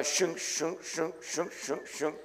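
soft scratchy shunk noises; scratching sound. Retold or imitated. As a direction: imitate scratching marks on a wall. Scratching tally marks on wall.

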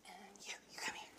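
hushed whisper picking a helper. Real, not acted. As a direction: whisper it so only they hear. Quietly picking another volunteer.

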